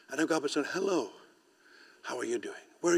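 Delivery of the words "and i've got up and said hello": ordinary delivery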